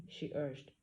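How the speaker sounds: plain reading voice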